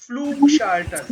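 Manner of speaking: emphatic model of Flugschalter, U held; proper U sound. Shown as a demonstration. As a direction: lean on the long oo in Flugschalter. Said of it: "proper U sound".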